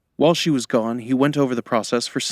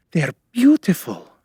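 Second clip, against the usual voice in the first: high, louder burst of awe; gasped admiration; 7 semitones higher, 5 dB louder than usual. A narrator's reading. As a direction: gasp it, marvelling. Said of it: "Manali gasped".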